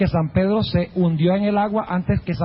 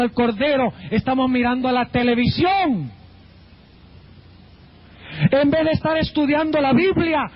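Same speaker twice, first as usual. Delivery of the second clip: raised-pitch, emphatic sermon delivery; fervent preaching; 5 semitones higher than usual. Real, not acted. Preacher urging congregation to look at the cross, fervent preaching. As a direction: punch key words like a preacher.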